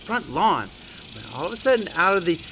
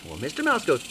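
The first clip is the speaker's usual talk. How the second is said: plain reading voice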